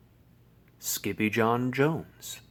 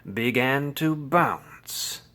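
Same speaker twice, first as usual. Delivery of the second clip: ordinary delivery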